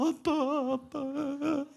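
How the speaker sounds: put-on high wailing child's apology, stretched out slow; loud weepy child voice; imitating sobbing child. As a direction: wail it like a little boy crying his heart out. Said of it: "선생님도 놀랄 만큼 울면서".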